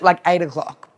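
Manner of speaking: ordinary delivery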